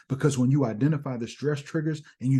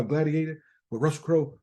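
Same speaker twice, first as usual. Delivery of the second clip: normal talking voice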